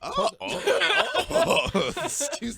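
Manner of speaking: high squeals of laughter; laughing. Real, not acted. Bursting out laughing.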